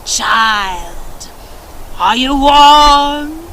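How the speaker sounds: put-on slow, booming old winter spirit; Grandfather Frost calling. A narrator's reading. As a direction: call out slow and grand from the treetop. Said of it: "he called out to Needlemaker's daughter".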